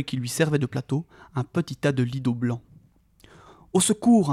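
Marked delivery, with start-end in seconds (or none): 3.7-4.3 high imploring call for help; pleading cry. A narrator's reading. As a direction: beg for help as you run. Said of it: "implorèrent Frodon et Sam".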